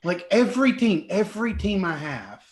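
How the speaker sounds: high-pitched exasperated complaint; exasperated disbelief. Acted. Ninja sees her team way back, exasperated. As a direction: gripe in disbelief at your teammates. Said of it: "she's just kinda like".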